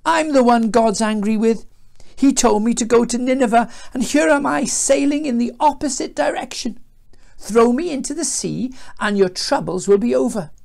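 storybook Jonah lamenting; groaning confession. A narrator's reading. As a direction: moan it out to the crew. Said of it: "Jonah groaned".

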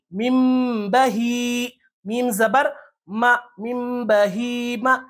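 very slow chanted spelling with long hummed m; spelling-out recitation. Shown as a demonstration. Teacher answering how it is read, slow hijje recitation with ghunnah. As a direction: build it syllable by syllable, stretching each joined sound. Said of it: "تو کیسے پڑھیں گے اس کو".

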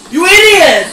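furious yell; angry shout. Real, not acted. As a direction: scream it at him. Yelling insult at player.

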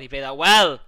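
sudden loud burst of praise; said really loud. Real, not acted. Gamer praising an enemy's play, suddenly loud. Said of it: "I said well really loud there".

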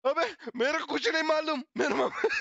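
mock scared mother. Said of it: "मेरे mummy को फट गए".

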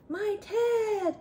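high, urgent storybook gasp; startled, high-pitched bear exclamation. A narrator's reading. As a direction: cry out in shocked recognition. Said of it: "gasped the bear".

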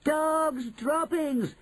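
high, drawn-out witches' shout; shouted cry. Acted. Witches take up the cry, high long shout. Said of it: "they shouted".

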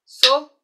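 "Sopa" as "so-pa", loud; syllable-clapped. Shown as a demonstration. Teacher counting syllables aloud, loud. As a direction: clap out "Sopa" in two beats.

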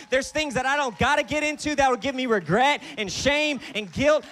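loud, high, emphatic sermon delivery; full-force preaching. Acted. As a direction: preach it hard, punching the key phrases.

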